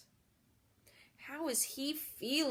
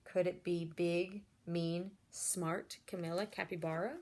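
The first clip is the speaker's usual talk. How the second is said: ordinary delivery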